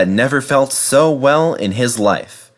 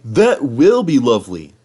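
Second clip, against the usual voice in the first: Henry the engine; 3 dB louder than usual. Acted. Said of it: "cried Henry".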